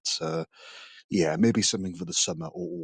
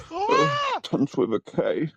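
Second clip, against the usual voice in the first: Lucille Ball style; slower than usual. Acted. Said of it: "became Lucille Ball at the end".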